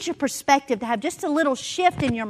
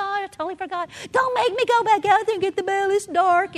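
put-on higher, pleading child's whine; whiny little girl; 8 semitones higher than usual. Daughter begging mom not to fetch mail, whining. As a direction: whine like a kid who won't go back out.

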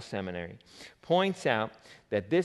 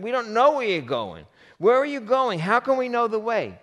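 much higher, loud, rushing confused questions; baffled, high and loud; 11 semitones higher, 8 dB louder than usual. Acted. Thomas protesting to Jesus he doesn't know the way, high and loud. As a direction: sputter out the questions, pitched up.

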